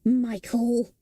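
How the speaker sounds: a hint of a sulky fire demon; lightly voiced fire demon. A narrator's reading. Fire demon Calcifer blames Michael, lightly voiced sulk. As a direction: grumble it at Michael.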